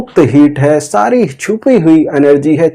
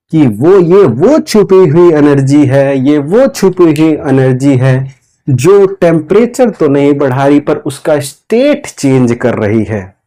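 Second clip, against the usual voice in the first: ordinary talk, nothing marked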